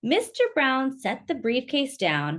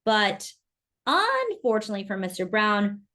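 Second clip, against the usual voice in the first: ordinary delivery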